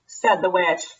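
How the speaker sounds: ordinary delivery, nothing marked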